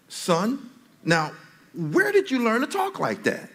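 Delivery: high, startled dad question; father asking. Retold or imitated. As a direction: act the shocked father. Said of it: "so I said".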